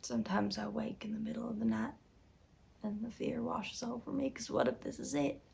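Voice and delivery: soft, fearful confession; hushed dread. Acted. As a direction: let the voice sink softly as you confide the fear. Confessing night-time dread.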